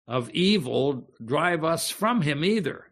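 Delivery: ordinary delivery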